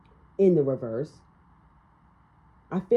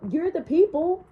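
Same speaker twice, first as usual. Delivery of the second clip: ordinary talk, nothing marked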